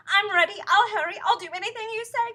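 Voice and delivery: high, loud, eager panic; desperate cry. A narrator's reading. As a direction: promise anything to be saved. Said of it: "cried Missus Twit".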